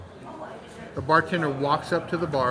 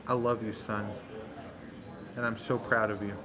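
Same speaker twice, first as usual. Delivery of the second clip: softer, lower tender words; tender father; 5 semitones lower, 8 dB quieter than usual. Retold or imitated. Father to his passed-out son, soft and tender. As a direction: say it gently to your son. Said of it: "he just says".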